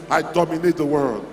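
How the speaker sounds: ordinary talk, nothing marked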